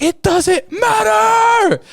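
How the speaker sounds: slow, high, overacted shout; mock-anguished drawn-out yell; retold scream. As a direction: scream it like a hammy stage actor. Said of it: "keeps screaming the same line".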